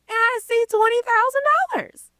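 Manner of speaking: very high, held-out disbelief; stunned amazement. Retold or imitated. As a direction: relive the shock of seeing the money. Retelling shock at huge payment.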